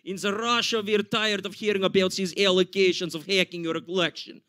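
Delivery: mock Russian accent, grumbling; belligerent drunk Russia. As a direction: lecture America in a thick Russian accent. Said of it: "I'm sure Russia gets a little too belligerent".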